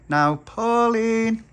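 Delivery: high, slow, drawn-out call; calling through letterbox. Acted. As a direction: sing out the name through the door.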